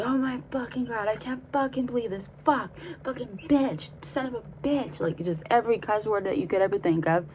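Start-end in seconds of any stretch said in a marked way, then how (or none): 0.0-5.0 higher, quieter imitation of a meltdown; mock exasperated cursing. Friend losing it at the gas station, mock exasperated. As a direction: curse in a high, strained retold voice. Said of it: "She's just like".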